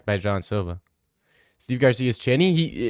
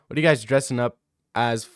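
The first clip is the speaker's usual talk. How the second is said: usual speaking voice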